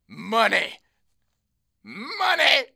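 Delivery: high, loud yell, rising on the repeat; drunk cheer. Retold or imitated. Two drunk guys pointing at the bartender, high escalating yell. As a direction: imitate plastered buddies hollering and pointing. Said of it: "that drunk thing, and they both point".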